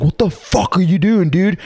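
high, mock angry husband. Angry husband yanks him.

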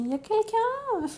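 high calling voice into an empty hall. Retold or imitated. Woman in labour calling into the empty maternity reception, high call. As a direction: sing out to see if anyone is there.

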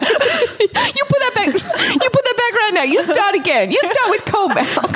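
high mock scolding voice. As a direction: scold like a strict mum.

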